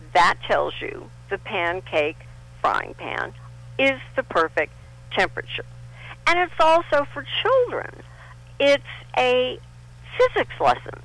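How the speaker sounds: usual speaking voice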